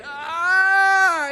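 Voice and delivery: a hammed-up yelp of disgust; disgusted scream. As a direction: scream as if you stepped in it. Kid steps in poop.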